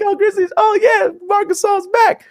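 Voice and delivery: very high mock-cheerful fan voice; cheery happy story. Mocking the feel-good media story in a high gushing voice.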